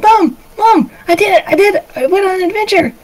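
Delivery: put-on very high, breathless-with-news kid; high-pitched thrilled child; excited little boy. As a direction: tell mom your big news, bursting with glee. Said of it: "he's all happy and excited".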